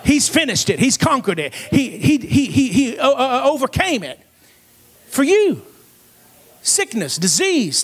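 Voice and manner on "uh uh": ordinary talk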